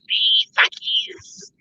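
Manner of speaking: slow, slurred mock drunk voice, ending in a hoarse whisper; drunk Aussie livestreamer. Mocking drunk Aussies who go live, with a slurred mock drunk voice. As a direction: lightly imitate a drunk bloke mouthing off on a livestream. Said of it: "get really drunk and loud and go live".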